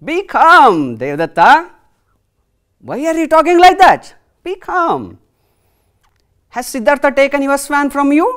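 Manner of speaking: high, loud call for calm; commanding king. Acted. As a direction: settle the shouting boy, firmly. King soothing angry Devdatta.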